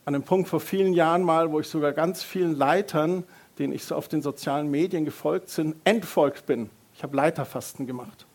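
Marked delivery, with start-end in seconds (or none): none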